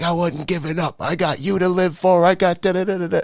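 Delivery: high, loud put-on dad voice; dad insisting he never quit; dad's voice. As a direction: voice the stubborn father high and loud.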